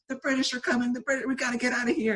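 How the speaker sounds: high, frantic warning cry; panicked Paul Revere. Retold or imitated. Hypnotized girl warning beachgoers as Paul Revere, high frantic alarm. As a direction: sound the alarm like a panicked town crier. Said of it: "running around to strangers going".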